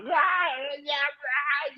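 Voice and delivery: high, strained demonic moans; a tormented creature gasping out the words; writhing demon groans. Acted. Demon writhing under incantation.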